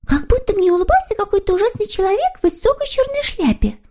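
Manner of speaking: put-on very high, thin girlish voice; little girl figure. A narrator's reading. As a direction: recount a dream in a high little voice. Snork Maiden telling her dream. (тоненький голосок, рассказ о сне)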